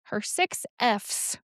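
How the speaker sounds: "six Fs" said slowly, each s and f drawn out carefully; slow down and work through "six Fs"; slow tongue twister. Shown as a demonstration. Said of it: "That is a hard thing to say".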